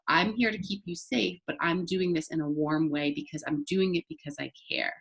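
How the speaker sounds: quiet, firm and caring; soft words, steady firm intent; stern yet soft. Shown as a demonstration. Teacher reassuring a child, stern yet soft. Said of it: "a stern yet soft".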